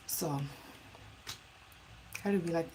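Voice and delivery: normal talking voice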